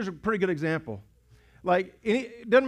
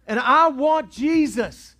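slow loud cry; 9 semitones higher, 9 dB louder, much slower than usual. Acted. First of repeated cry.

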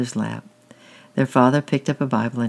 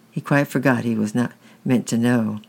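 ordinary delivery, nothing marked

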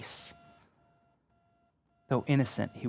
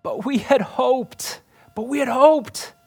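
slow, raised and louder anguished cry; despairing; 12 semitones higher, 8 dB louder, slower than usual. Retold or imitated. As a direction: let the despair break through the words. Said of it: "Did you hear the despair".

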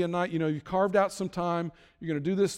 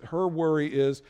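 normal talking voice

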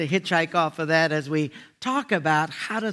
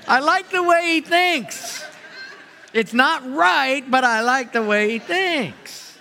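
laughing relish; 7 semitones higher, 4 dB louder, slower than usual. Real, not acted. Pastor relishes the joke's punchline.

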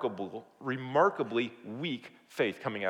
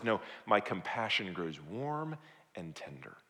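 slow, low, soft reassurance; warm and tender; 7 semitones lower, 8 dB quieter than usual. Acted. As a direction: correct them gently, lingering on the words.

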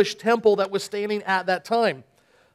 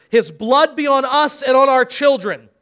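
higher, louder crowd answer; crowd cry; 4 semitones higher, 6 dB louder than usual. Acted. Crowd answers Pilate, loud cry.

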